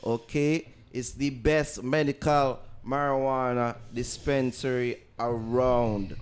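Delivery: upbeat announcer ad read. Acted. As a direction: sell it like a radio spot. Podcast ad read.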